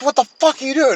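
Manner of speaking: high, loud startled imitation of sister; hammed-up alarm; mock alarmed shout. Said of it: "She's like".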